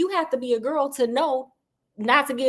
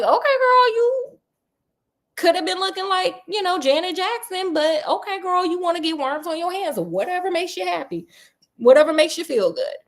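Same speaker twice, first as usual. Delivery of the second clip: put-on sassy girl talk; 5 semitones higher than usual. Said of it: "And it's like".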